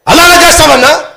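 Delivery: high, loud indignant shout. Retold or imitated. Husband bursting out at the preacher, high loud shout. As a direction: yell the question, startled and outraged. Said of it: "ఎందుకు అలా అరుస్తున్నావు".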